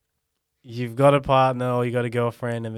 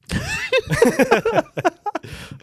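high squeal laugh; 3 dB louder than usual. Real, not acted. Laughing at own joke.

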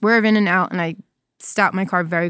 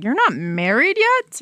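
high, slow, incredulous question; mock disbelief; 6 semitones higher, slower than usual. Southerners asking the newcomer about marriage, incredulous. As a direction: imitate a scandalized nosy questioner. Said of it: "everyone I met was like".

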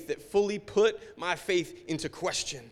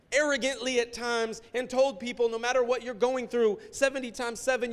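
higher, pressing admissions; agitated confession; 7 semitones higher, 4 dB louder than usual. Retold or imitated. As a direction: pastor answering God's questions, high and agitated. Confessing answers to God.

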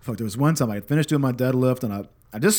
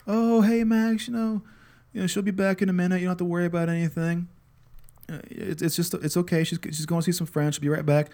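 much higher, gentle soothing voice; calm a crying toddler, much higher; soothing a child; 7 semitones higher than usual. Retold or imitated. Dad comforting his crying son, much higher and gentle.

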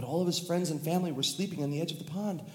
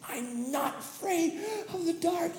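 much higher, slow cry; triumphant cry; slower than usual. Acted. Tommy in midair, high triumphant cry. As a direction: sing out your victory.

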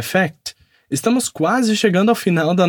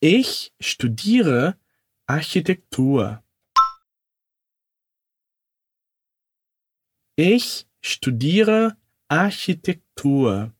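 slow, careful sentence for repeating; slow learner model; slow demo; 2 semitones lower, much slower than usual. Shown as a demonstration. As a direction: say it slowly so they can echo. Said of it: "Isso mesmo".

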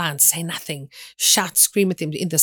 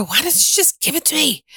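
high-pitched mock whine to a parent; exasperated plea; 9 semitones higher than usual. Speaker retells begging mother for money, mock exasperated plea. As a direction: plead in a jump of pitch, playing your younger self.

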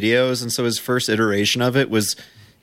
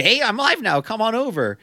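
much higher, sing-song fake friendliness; mock eager streamer; 11 semitones higher than usual. Host mimics the fan begging for raids, high sing-song. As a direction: chirp it like a clueless fan. Imitating his stream invites.

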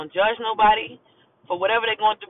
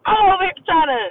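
frustrated laugh; 8 semitones higher, 4 dB louder than usual. Real, not acted.

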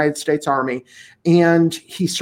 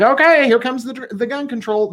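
mock resigned quote; 7 semitones higher than usual. Mocking resigned gun owners.

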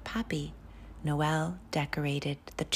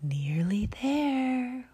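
slow, higher airy whisper; drawn-out cloud whisper; 7 semitones higher, much slower than usual. Acted. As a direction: stretch it out like a drifting cloud. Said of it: "whispered the cloud".

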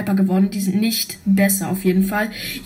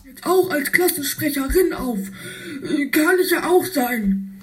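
high put-on kid voice; character voice; 5 semitones higher than usual. Spike planning to vote for himself, high put-on voice. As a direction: pitch it up like a cartoon kid.

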